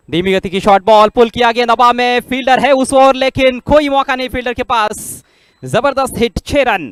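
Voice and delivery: loud, rising live play-by-play; excited cricket commentary. Real, not acted. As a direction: call the six at full pitch. (जोशीली cricket commentary)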